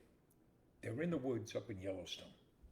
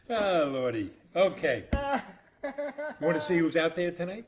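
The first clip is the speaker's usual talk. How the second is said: much louder, higher host voice; loud host call; 7 semitones higher, 12 dB louder than usual. Real, not acted. Host to the audience, loud cheerful call to see who's watching. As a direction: announce it to the audience.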